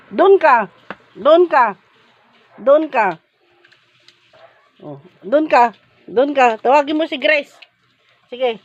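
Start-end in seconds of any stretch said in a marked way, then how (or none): none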